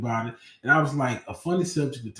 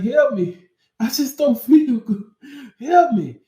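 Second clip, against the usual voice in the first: much higher and louder, pleading; desperate plea; 13 semitones higher, 10 dB louder than usual. Retold or imitated. Student begging his English teacher for help, high and desperate. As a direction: beg for help, voice cracking up high.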